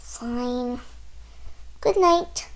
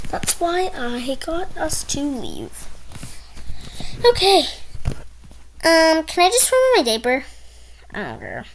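usual speaking voice

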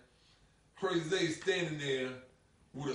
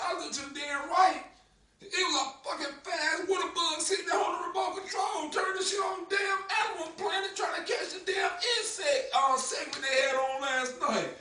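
panicked telling; 13 semitones higher than usual. Retold or imitated. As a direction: shout it out, freaked by the bug. Panicked telling of water bug.

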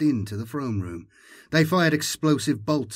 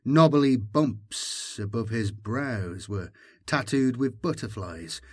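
ordinary delivery

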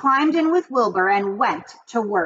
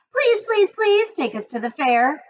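high pleading gosling voices, much higher; begging goslings; 9 semitones higher than usual. A narrator's reading. Goslings beg their mother for the fair, high and pleading. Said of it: "begged the goslings".

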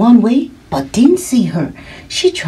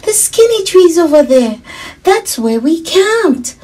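put-on high, loud excited child; grinning delight; 10 semitones higher, 4 dB louder than usual. A narrator's reading. Girl spotting her camp's trees, high delighted voice. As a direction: exclaim high through a big grin. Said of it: "said with a big grin".

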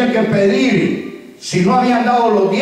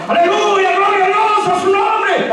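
high, mock jubilant shout of praise; imitating a congregant's high, excited shouted hallelujah; 10 semitones higher than usual. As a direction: yell the praise like an eager congregant. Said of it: "Antes me gritaba".

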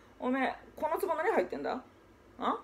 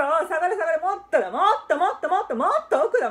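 louder, higher eager shouts; father giddy over the kite, shouting; excited calling out; 4 semitones higher, 6 dB louder than usual. Acted. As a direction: call to the boy across the field.

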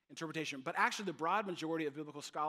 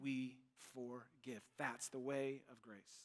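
much slower and softer; slow gentle close; 3 semitones lower, 7 dB quieter, much slower than usual. Acted. Preacher closing the point to the church, slow and soft. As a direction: lay it down gently.

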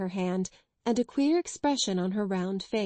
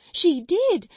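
much higher and louder, falling hard; hysterical cry; 10 semitones higher, 7 dB louder than usual. Acted. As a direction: cry it out at the top of her voice. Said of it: "her hysterics got the better".

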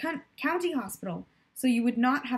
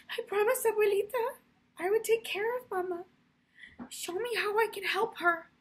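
much higher, crying prayer to Our Lady; tearful prayer; 11 semitones higher than usual. Acted. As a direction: pray through tears as if a child begging. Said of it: "she said through her tears".

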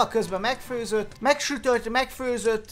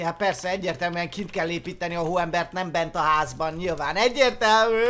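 ordinary delivery

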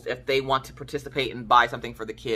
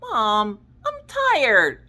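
put-on whiny tired kid; 8 semitones higher, much slower than usual. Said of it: "He's like".

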